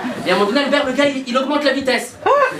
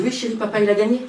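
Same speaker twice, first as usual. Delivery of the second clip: father gently fibbing to his son; tender reassurance. Retold or imitated.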